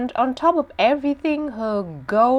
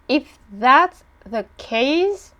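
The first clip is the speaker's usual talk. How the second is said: ordinary talk, nothing marked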